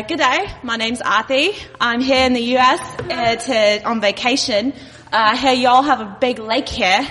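slightly shaky imitation Australian accent. Visitor on vacation greeting the locals, imitated Australian accent. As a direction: have a go at an Aussie accent. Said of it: "what if I were Australian?".

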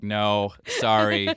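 loud, curt businessman brush-off; a loud, dismissive German local; impatient businessman. Acted. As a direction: reject them loudly, with no patience. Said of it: "patience businessman just being like".

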